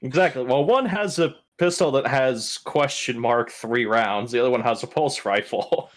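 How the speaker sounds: ordinary talk, nothing marked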